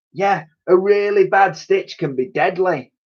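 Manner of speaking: lightly voiced old soldier telling his tale; Titch's voice. A narrator's reading. Titch replies.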